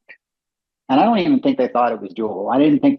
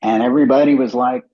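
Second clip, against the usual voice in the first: usual speaking voice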